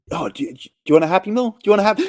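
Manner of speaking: quick, high imitated pleading; frantic coaxing. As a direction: coax a crying kid, rising toward panic. Sandler soothing crying child, acted.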